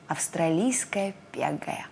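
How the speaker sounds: «Австралийская пегая» шёпотом, медленно; прошепчи медленно, будто секрет; таинственный шёпот. A narrator's reading. Лягушонок отвечает таинственно. (шёпот лягушонка)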